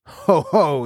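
spoken-out giantess laugh, only lightly menacing; terrible laugh. A narrator's reading. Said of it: "she laughed terribly".

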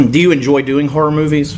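normal talking voice

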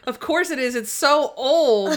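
teasing sarcasm, hammed up; sarcastic quip. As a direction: roll your eyes at the ancient camera. [name] mocking old camera.

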